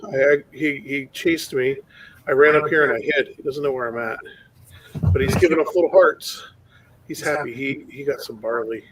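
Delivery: ordinary delivery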